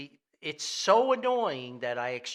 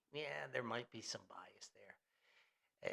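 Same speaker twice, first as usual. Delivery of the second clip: whispered aside; 6 semitones lower, 12 dB quieter, slower than usual. Real, not acted. Sly aside about disliking [name].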